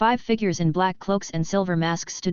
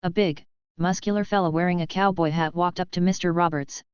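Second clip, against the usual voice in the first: usual speaking voice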